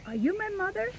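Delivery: high, small questioning chick; baby bird voice. Acted. Baby bird asking the kitten, high and hopeful. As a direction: ask it up high like a little bird.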